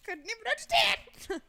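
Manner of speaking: high, loud mimicked yell; mock outburst. Co-actor teases partner's overdone line, mimicking the yell. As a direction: parrot the shriek back at full pitch.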